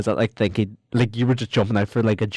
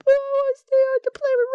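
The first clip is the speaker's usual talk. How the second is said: crying plea. Acted. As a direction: wail it like a crying child. Henchman begs Batman tearfully.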